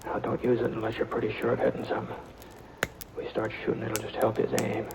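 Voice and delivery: tense near-whisper in a dark cave; hushed. Acted. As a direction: keep it hushed so the outlaw can't hear. Sneaking up on hidden outlaw.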